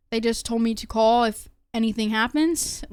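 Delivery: ordinary talk, nothing marked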